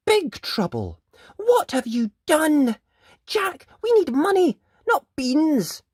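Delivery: put-on mother shrieking at the boy; scolding mother. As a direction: tell him off in exasperation. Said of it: "cried his mother".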